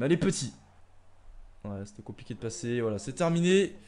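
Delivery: normal talking voice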